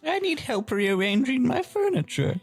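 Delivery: put-on high, quavery old lady; squeaky elderly singsong; little old lady voice. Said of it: "her little old lady voice".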